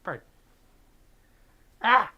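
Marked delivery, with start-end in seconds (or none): 1.8-2.1 sudden loud high yelp; a short shocked cry, loud and high; startled yelp. Real, not acted.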